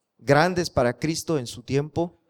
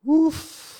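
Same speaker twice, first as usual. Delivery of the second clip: 1 resoplido agudo; 12 semitones higher than usual. Acted. Resoplido de asombro.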